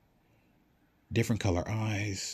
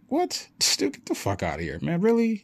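high, startled "what", then loud disbelief; acted outburst of disbelief; incredulous; 10 semitones higher, 5 dB louder than usual. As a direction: play a friend who can't believe it. Acted listener disbelieves vampire claim.

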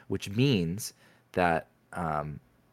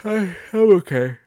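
talking through a yawn; stretched out by a big yawn; 12 semitones higher, 8 dB louder than usual. Real, not acted. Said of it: "I apologize for the yawn".

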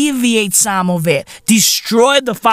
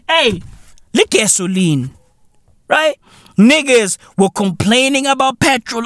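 ordinary delivery

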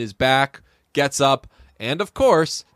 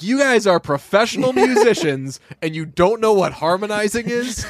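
mock incredulous; 8 semitones higher than usual. Speaker mocking band's ignorance.

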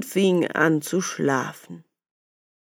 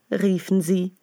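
ordinary delivery, nothing marked

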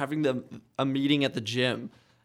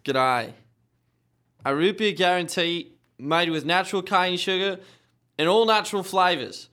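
put-on Australian accent, reading aloud; 2 semitones higher than usual. Reading a root beer cup on request, Australian accent. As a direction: read it like an Australian. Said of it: "do it as an Australian".

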